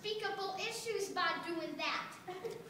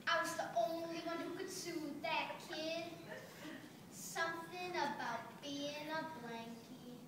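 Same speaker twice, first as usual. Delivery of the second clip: tender; 7 dB quieter than usual. Acted. Blanket remembers soothing a kid after nightmares, tender. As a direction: let it sink softly and tenderly.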